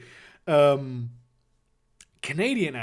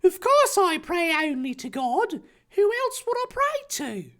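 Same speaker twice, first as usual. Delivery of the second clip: very high, scandalised character voice; high-pitched, aghast boy; horrified gasp. Acted. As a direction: protest in mock horror, pitched way up. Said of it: "Harry gasped in horror".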